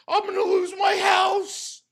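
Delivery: fake-sob it like a whiny victim; mock crying wail. Mimicking Phil's begging to his audience, mock sob. Mocking Phil, crying shout.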